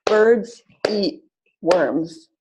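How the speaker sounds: slow, BIRDS and WORMS punched on each beat; teacher modelling rhythm, slow and clear; stress-timed demo. Shown as a demonstration. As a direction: clap it out, one beat per stressed word. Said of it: "So line one".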